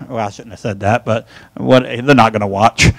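drops to a hushed, low aside; half to himself, as if nobody should hear; quiet aside. Real, not acted. Guilty aside about naming someone.

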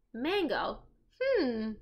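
usual speaking voice

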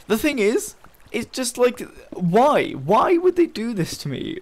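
high-pitched, whiny why-me complaint; exasperated pleading. Real, not acted. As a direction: plead in frustrated disbelief. Complains to map maker after death.

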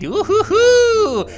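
slow high hooting glee; gleeful hoot. Real, not acted. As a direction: hoot with delight. Celebrating the unlock.

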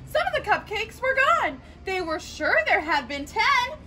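lively storybook reading; reading to children. A narrator's reading. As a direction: read it brightly to little kids. Reading a picture book aloud.

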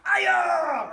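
sharp martial arts yell; full-power karate kiai; kiai shout. Shown as a demonstration. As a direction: explode the yell from the gut. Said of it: "when you shout from wherever you're at".

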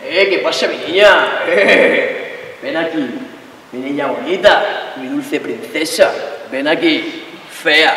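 low, slow crooning lover's voice; teasing boyfriend calling his girl over; mock sweet boyfriend.

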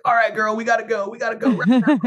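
high, hurried mock rush; urgent excitement. Retelling rushing his fiancée out at dawn, excited and high. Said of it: "that's when I was like".

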